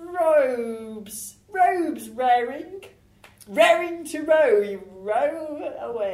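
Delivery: slow, high, deliberate lines; slow, drawn-out. Acted. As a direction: stretch each word out slowly. Improv scene, alliteration delivered slowly.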